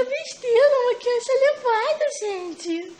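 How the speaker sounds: put-on high squeaky voice for the baby; mother speaks as her baby, high and squeaky; baby talk. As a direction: speak high as the little one. Mother voicing baby, playful.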